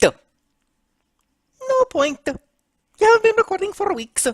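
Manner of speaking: high, insistent character voice; accusing Mario. Acted. Mario pressing Luigi about recording, accusing. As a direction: rattle off the complaint way up high.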